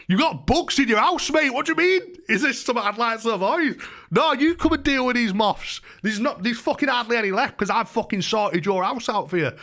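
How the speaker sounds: high-pitched, incredulous mock protest; indignant retort. Tenant telling landlord about bugs and moths, indignantly incredulous. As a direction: throw it back at him in outraged disbelief.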